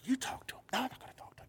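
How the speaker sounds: hushed whisper between two nervous friends. Retold or imitated. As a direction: whisper it so he won't hear. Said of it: "they're probably whispering to themselves".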